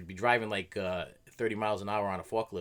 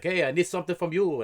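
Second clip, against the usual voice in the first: higher, louder call across the floor; coworker calling out; 9 semitones higher, 8 dB louder than usual. Retold or imitated. Coworker calls out for something, loud and higher. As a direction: call out to get his attention. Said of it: "So I'd be like".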